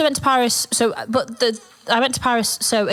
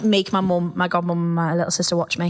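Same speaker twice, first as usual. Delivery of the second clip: usual speaking voice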